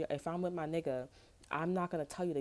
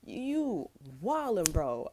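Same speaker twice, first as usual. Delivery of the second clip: drawn-out disbelief; 2 semitones higher, 4 dB louder, much slower than usual. Retold or imitated. Mock disbelief at a friend.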